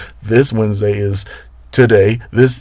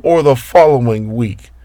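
ordinary talk

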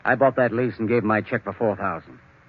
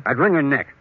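louder, harsh threat; menacing; 5 semitones higher, 6 dB louder than usual. Acted. Lawyer telling Henry how he'd deal with her, harsh threat. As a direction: spit it out like you mean it. Joe's murderous suggestion.

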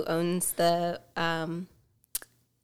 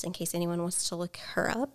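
ordinary delivery

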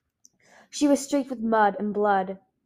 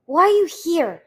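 higher, louder spitting fury; spat; 8 semitones higher, 10 dB louder than usual. A narrator's reading. Sister lashing out at her brother, spitting anger. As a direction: spit the words at him. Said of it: "she spat".

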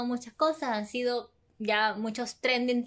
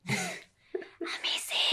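whispering; much slower than usual. Real, not acted. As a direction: breathe it as a sly aside. Teasing aside during the chat.

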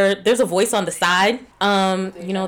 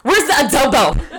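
higher, hammed-up Spanish accent; teasing put-on Spanish accent, pitched up; fake Spanish accent; 4 semitones higher than usual. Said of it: "can't say it in that accent".

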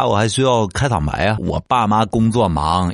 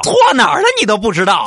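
high, fast scolding parent voice; mock mother scolding, high and fast; angry parent; 13 semitones higher, 4 dB louder, faster than usual. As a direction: shriek it like a furious mother. Parent scolding child in skit.

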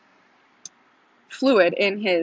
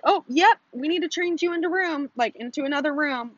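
high-pitched mock nurse voice; imitated nurse; 8 semitones higher than usual. Nurse telling patient to change rooms, high imitated voice.

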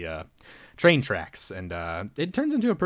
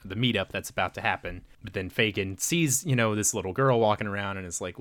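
ordinary talk, nothing marked

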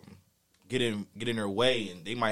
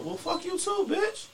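retold comeback. Answering the rider's middle finger, high mocking retort. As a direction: fire the insult back in a high mocking voice. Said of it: "I rebuttal".

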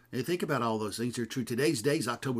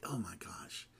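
breathy whispered aside to himself; a private whisper under the breath; 5 semitones lower, 11 dB quieter, slower than usual. Real, not acted.

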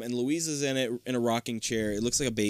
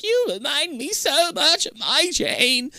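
put-on high, slow doting old woman; old lady voice; 4 dB louder, slower than usual. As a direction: croon like a ghostly grandmother. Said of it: "she's like".

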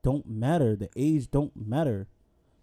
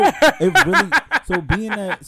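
ordinary delivery

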